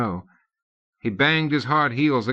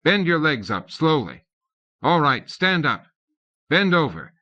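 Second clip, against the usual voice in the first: harsh commands; 6 semitones higher than usual. A narrator's reading. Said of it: "He had to speak harshly".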